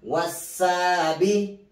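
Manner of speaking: slow chanted tajweed recitation, spelling each letter; Quran recitation drill. Shown as a demonstration. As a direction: chant the letters and the word for students to repeat. Teacher chants spelling and Quran words. (ترتيل تعليمي بطيء مع تهجئة الحروف)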